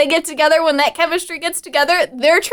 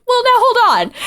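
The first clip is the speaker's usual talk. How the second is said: laughing mock objection; 12 semitones higher than usual. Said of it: "Charlie and Dennis are like".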